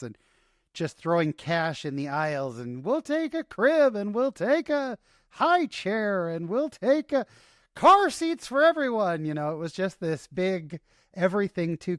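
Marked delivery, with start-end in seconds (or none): 2.8-9.3 much higher and louder, slow, giddy calling out of orders; excited shopping spree. Retold or imitated. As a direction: shout the purchases like a kid let loose in a toy store. Retold frantic shopping spree.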